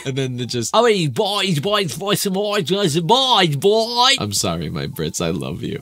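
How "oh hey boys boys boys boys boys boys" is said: high, loud, hammed-up cockney hollering; mock cockney. Host imagining cockneys in the bedroom, mock cockney shouting. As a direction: holler it over and over in exaggerated cockney. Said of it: "That's how they talk".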